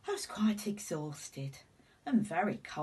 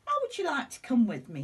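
eager offer; 7 semitones higher, 8 dB louder, faster than usual. A narrator's reading. Mr Robinson's excited offer.

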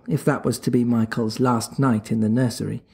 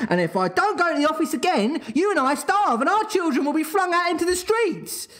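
high, rising, melodramatic warning that speeds up; sternly; 12 semitones higher than usual. Acted. Father warns his wife over the tie, high and theatrical. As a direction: spiral into doom, faster with each clause. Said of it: "he went on sternly".

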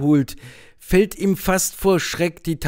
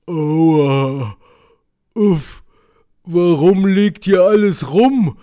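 groaning bear; 3 semitones higher, 6 dB louder, slower than usual. Acted. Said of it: "stöhnt der pelzige Berg".

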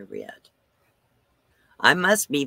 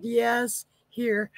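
mock innocence; 10 semitones higher than usual. Handing over wet microphone.

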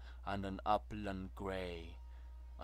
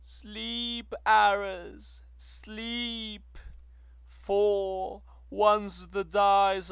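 high, loud, slow imploring chant to the war god; soaring high-pitched lament; woman's pleading prayer; 10 semitones higher, 14 dB louder, slower than usual. Acted. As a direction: pray to him like a desperate woman, voice lifted high. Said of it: "is borne a woman's prayer".

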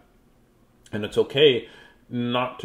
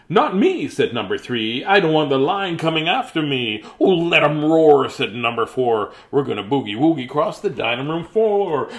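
put-on reading to children; 3 semitones higher, 4 dB louder than usual. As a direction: read it out big for the kids. Reading picture book to children.

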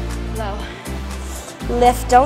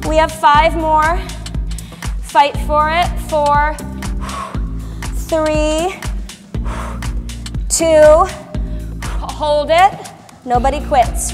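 halftime pep speech; 4 semitones higher, 5 dB louder, slower than usual. Real, not acted. Instructor pushing through final count.

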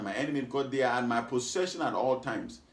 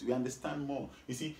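normal talking voice

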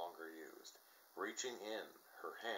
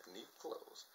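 ordinary delivery

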